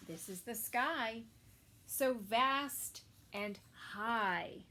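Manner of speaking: ordinary delivery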